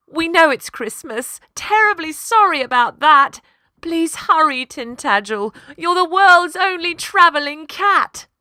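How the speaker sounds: loud, high, panicky client; frantic plea. Acted. Householder begging the cat on the phone, loud and panicked. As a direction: hurry the cat over in a fluster.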